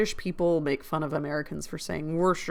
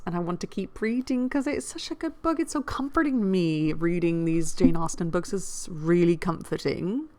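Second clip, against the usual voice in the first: ordinary delivery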